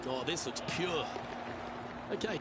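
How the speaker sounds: ordinary talk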